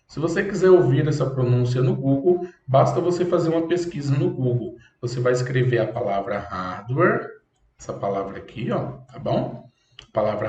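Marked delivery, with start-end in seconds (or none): none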